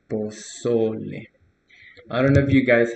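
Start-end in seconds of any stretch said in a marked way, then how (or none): 0.1-1.3 pozole, slow, so stressed; stressed middle syllable. Shown as a demonstration. Teacher reminding learners where pozole is stressed. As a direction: say pozole slowly, weight on so. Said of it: "the stress would be in this, uh, syllable".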